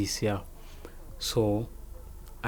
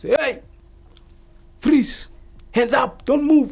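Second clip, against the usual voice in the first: high, loud mock arrest shout; police shout; 14 semitones higher, 5 dB louder, slower than usual. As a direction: shout orders like police storming in. Acts out police making the arrest.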